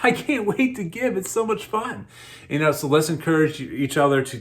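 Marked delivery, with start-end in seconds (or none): 0.0-2.0 giddy. Retold or imitated. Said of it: "It's almost giddy".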